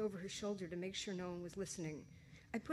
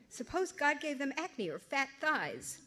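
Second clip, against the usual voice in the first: laughing; 7 semitones higher, 6 dB louder, slower than usual. A narrator's reading. Wry inner thought, amused.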